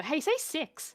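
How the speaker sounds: teasing New Zealander. Retold or imitated. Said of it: "to a New Zealander".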